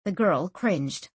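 plain reading voice

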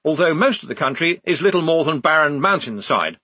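speechify it to an audience; officer talking down as if to a public meeting. Acted. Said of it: "in his talking to a meeting voice".

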